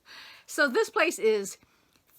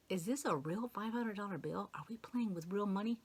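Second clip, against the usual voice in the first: much softer, hushed wondering to herself; inner thought; 11 dB quieter than usual. Retold or imitated. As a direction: murmur it like a private thought. Thinking in her head.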